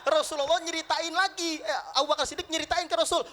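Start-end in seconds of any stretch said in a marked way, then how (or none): none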